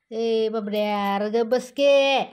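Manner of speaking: loud drawn-out warning; loud slow scolding. Real, not acted. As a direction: stretch each word to make the kitten stop. Scolding the kitten.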